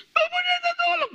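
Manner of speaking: high, loud wailing cry for help; panicked scream for help. Retold or imitated. Wife screaming to the pastor from behind during prayer, panicked scream. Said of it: "sudah teriak di belakang".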